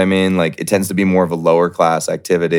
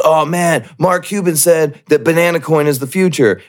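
higher-pitched wide-eyed hype impersonation; mock excited fan; 8 semitones higher than usual. Mocking fan who listens to billionaires, excited and high. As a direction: gush like a gullible believer, mockingly. Mimicking a gullible coin fan.

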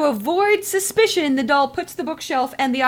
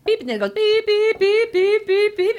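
high mock beeps; beeping noise; 7 semitones higher than usual. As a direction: chirp out beep beep. Imitating a machine beep.

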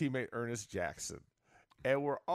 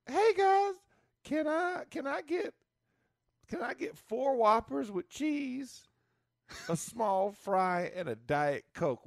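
much higher, slow mock voice of a teammate ordering; Ernest's voice; 11 semitones higher, slower than usual. Teammate in the trunk orders four Whoppers, high mock voice. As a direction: squeak out the big order innocently. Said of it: "Ernest goes".

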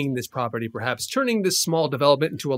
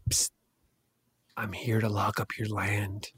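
low, slow, hushed villain threat; a burglar creeping up on a rival; menacing burglar; 8 semitones lower, 8 dB quieter than usual. Acted. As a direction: lean in and threaten softly. Burglar character menacing player.

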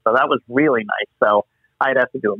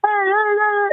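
much higher mock yell of hey; imitating Tom Hanks' yell; slower than usual. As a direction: copy the famous yell, pitched way up. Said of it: "Hey, yell like this".

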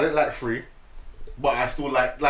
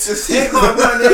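higher and louder hype; hyped; 8 semitones higher, 7 dB louder than usual. Retold or imitated. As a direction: shout it like an eager fan.

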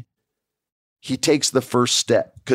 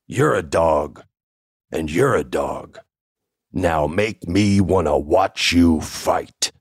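slow, heavy boss voice pointing at each one; Vince laying down the challenge; 3 semitones lower, slower than usual. Retold or imitated. Boss setting a premise for two wrestlers, slow and heavy. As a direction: drag it out like a stern boss making them squirm. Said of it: "And Vince says".

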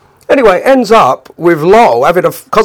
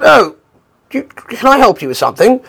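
high-pitched mock-posh voice; affected posh accent; 9 semitones higher than usual. Cockney Lol offering the couple help, affected posh accent. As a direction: put on a posh accent, all politeness. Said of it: "affected a posh accent".

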